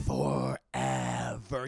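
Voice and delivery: very low, drawn-out growly doom; ominous Beast. Retold or imitated. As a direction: draw it out deep and slow like a monster. Beast pronounces the life sentence.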